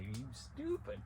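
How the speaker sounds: high mock angry voice; hammed-up scolding father; the father scolding his son as an idiot; angry dad. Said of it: "He was like".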